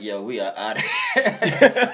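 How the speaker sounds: half-laughing quote of the guys leaving. Retold or imitated. Married friends bailing on the night, laughing.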